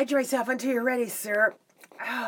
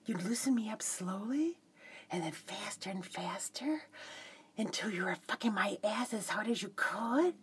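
sultry whisper; 5 semitones lower, 12 dB quieter than usual. Acted. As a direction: whisper it seductively.

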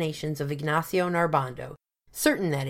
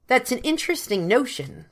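ordinary delivery

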